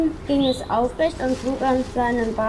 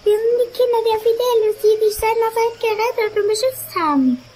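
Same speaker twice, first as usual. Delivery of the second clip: much higher and louder, thrilled to see Marvin; excited high greeting; 8 semitones higher, 4 dB louder than usual. Acted. Children greeting Marvin at last, high excited voices. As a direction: greet him like long-lost family, high and loud.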